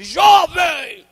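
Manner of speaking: high, loud prophetic shout; missionary crying out Jovem; calling out. Retold or imitated. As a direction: call the young man out from the pulpit. Missionary calls out to him.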